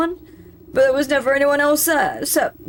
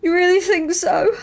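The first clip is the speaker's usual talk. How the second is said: teary rogue voice; 7 semitones higher than usual. Acted. Blubbering rogue asks hopefully.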